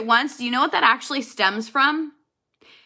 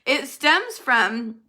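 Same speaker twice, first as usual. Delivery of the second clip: ordinary talk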